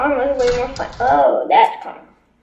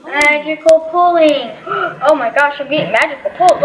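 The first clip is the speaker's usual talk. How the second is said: ordinary talk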